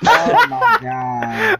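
slow, high, loud wheezing laugh; dying of laughter; laughing hard. Real, not acted. Both crack up laughing.

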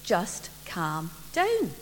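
slow, soft and soothing; a mother slowly soothing her excited daughter; calming. Acted. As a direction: settle the child down gently. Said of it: "Mum said".

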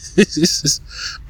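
breathy laugh. Real, not acted. Laughing at the absurd request.

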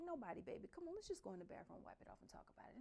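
soft, hurried soothing whisper; calm her down quietly so grandma won't hear; soothing hush. Retold or imitated. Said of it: "In a different tone".

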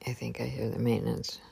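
hurried whisper so he won't hear; real whisper. Real, not acted. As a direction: whisper it fast, hiding from the visitor. Hears maintenance man arriving.

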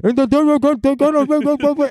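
high, slow, New Yorky Bernie voice; Bernie impression. Comic's slow, high Bernie impression, close to the mic. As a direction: stretch it out like a bad Bernie. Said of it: "doing that voice".

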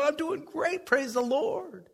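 high, slow fake-pious praise; fake pious cheer. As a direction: sing out a hollow praise. Fake cheerful church reply.

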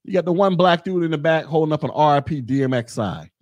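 usual speaking voice

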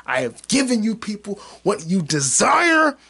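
slow, high, booming proclamation; mock grand proclamation. Host proclaiming to his audience, slow and grand. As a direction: declare it like a self-important king. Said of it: "grand proclamation to viewers".

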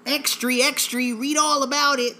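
high, loud street newsboy cry; newsboy call. Pretend. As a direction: call it out like a paperboy. Mock newsboy headline call.

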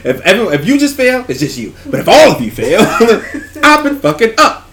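high, loud laughing rant. Real, not acted. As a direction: laugh while admitting failure. Laughing rant about failing.

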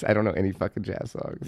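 ordinary talk

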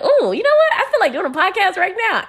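excited idea in the shower; sudden excitement. Retold or imitated. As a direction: squeal like the idea just hit. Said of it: "I was like".

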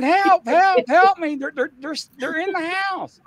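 high, loud mock cry for help; retold screaming. Caller screaming for police help, acted panic. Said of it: "And they're screaming".

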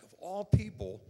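exasperated. Retold or imitated. Sees son's friend's mother.

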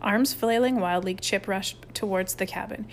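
ordinary delivery

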